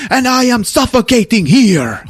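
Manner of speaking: high, loud mock anguish; melodramatic outburst. Husband answering wife in their fight, melodramatic outburst. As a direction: wail it loudly as the hammed-up husband.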